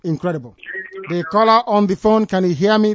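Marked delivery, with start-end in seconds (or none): 1.1-2.9 loud, raised voice to the phone; calling out. Real, not acted. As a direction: call out to a caller who can't hear.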